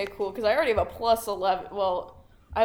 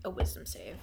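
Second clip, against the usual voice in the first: whisper; 12 semitones lower, 6 dB quieter than usual. Real, not acted. Player answers question, whisper.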